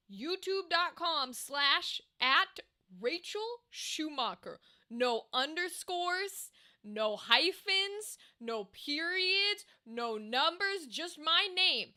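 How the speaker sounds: slow, deliberate, every item spaced out; slow emphatic dictation; spelled out slowly. Shown as a demonstration. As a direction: lay it out word by word so nobody misses it. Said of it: "let me be very clear".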